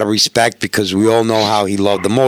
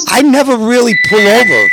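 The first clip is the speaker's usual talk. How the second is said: a hint of the cop, higher pitched; lightly voiced cop, raised in pitch; retold cop; 11 semitones higher, 4 dB louder than usual. Cop to a speeding Harley rider, lightly imitated.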